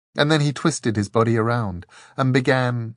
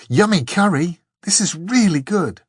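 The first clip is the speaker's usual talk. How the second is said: happy with mouth full; 9 semitones higher, 6 dB louder than usual. A narrator's reading. Dor eating happily.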